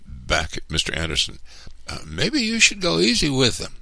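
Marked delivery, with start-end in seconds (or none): 1.9-3.7 high-pitched, timid workman; hesitant caution. A narrator's reading. Tom cautions his boss to go easy, high and hesitant. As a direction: warn the boss carefully, voice high. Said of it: "he cautioned".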